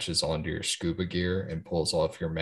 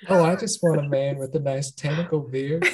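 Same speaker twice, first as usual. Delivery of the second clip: higher, laughing mock Southern belle; 6 semitones higher, 4 dB louder than usual. Teasing her friend's belle act, laughing Southern Belle voice. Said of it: "are a Southern Belle now".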